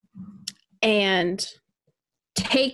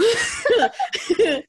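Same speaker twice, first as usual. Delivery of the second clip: wild rambling cackles; 11 semitones higher than usual. Pretend. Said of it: "the old witch of the mountains".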